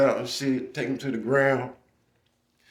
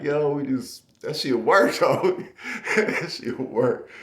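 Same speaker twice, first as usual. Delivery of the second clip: laughing; 5 semitones higher, slower than usual. Real, not acted. Telling teammate the trick worked.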